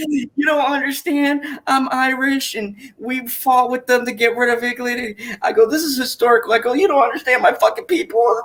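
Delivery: high-pitched mock outrage, laughing; mock indignant. Retelling his reply to Bitchy, high mock indignation. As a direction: play the wounded Irishman, high and hammed-up. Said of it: "I was like".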